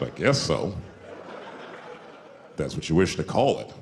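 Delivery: a lightly voiced solemn Native elder; stoic Native man. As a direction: play it solemn and unbothered.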